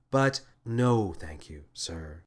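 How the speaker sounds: slow, deadpan refusal; flat. A narrator's reading. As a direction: dryly decline. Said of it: "said Flaley flatly".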